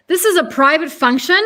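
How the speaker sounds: loud indignant yell. Acted. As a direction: shout it in outrage. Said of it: "Jonathan yells".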